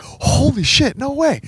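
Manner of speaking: high, squealing holy shit; amazed disbelief. Retold or imitated. Hearing he gets pinned today, in high happy disbelief. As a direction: gasp it out in happy shock.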